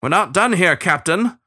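ruffled, indignant parting shot; indignant threat. A narrator's reading. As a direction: insist sharply to the captain. Starling threatens captain after being dropped.

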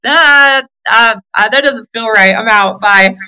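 high, stammering quick escape; mock nervous exit. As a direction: bolt out hesitantly. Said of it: "I'm like".

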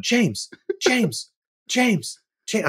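high insistent calling of a name. Retold or imitated. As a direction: call across the carpet to get his attention. Woman calling out on red carpet.

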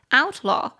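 Outlaw stressed on out; stress on first syllable. Shown as a demonstration. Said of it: "中音节比较高的音节在第一音节".

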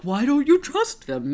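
high-pitched mock puzzled question; imitating naive bewilderment. Sam questions her distrust, mock-bewildered. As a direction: plead it high, as if baffled. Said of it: "and yet Sam's like".

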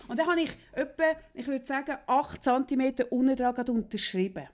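ordinary talk

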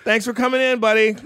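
high, loud imitation of a polite brush-off; half-laughing casting voice; mock dismissive casting director. Casting people dismissing him.

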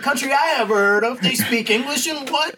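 high, laughing threat; imitating Jules with a laugh; mock Jules. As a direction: quote the hitman while cracking up.

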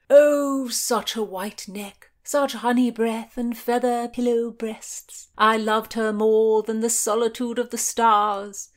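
high, loud swooning praise; gushing rapture. A narrator's reading. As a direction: gush like a lovesick old romantic. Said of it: "Sir Henry gushed".

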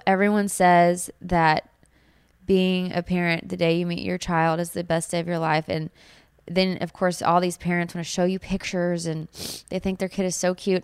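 ordinary delivery

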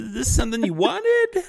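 high squeaky scandalized version; hammed-up scandalized stranger; mock shocked asker. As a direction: say it up high and prim. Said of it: "they're like".

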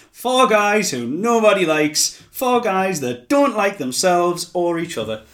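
loud, high, drawn-out mock commentator; Eric Bischoff. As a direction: belt it out as a slow, hammed-up TV announcement. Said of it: "comment here from Bischoff".

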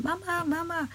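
put-on high, soft child's cry for mama. A narrator's reading. Children on the beach calling for their drowning mother, high and soft. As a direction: call like a lost little child.

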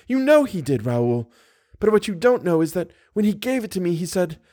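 put-on high-pitched young woman in distress; agitated heroine. A narrator's reading. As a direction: explain anxiously in a high voice. Voices Christine.

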